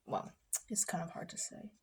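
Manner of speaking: very soft, near whisper; hushed hesitation. Real, not acted. Vlogger unsure how to explain the heating, hushed. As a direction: let it sink almost to a whisper.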